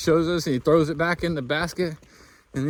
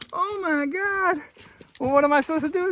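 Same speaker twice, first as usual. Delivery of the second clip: stunned disbelief; 11 semitones higher than usual. Retold or imitated. Stunned by gift of grouse.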